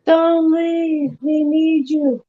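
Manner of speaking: high, drawn-out mock plea; mock pleading. Friend begs Emily to stay, mock pleading. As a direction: beg in a put-on, stretched high voice.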